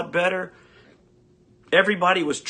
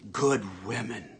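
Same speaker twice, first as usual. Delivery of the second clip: much lower, slow announcer tag line; deep mock ad sign-off; 8 semitones lower, 10 dB quieter, slower than usual. Ranter ending the imagined tampon ad, low slow sign-off. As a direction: drop the voice low and intone it. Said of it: "a tampon company ran an ad".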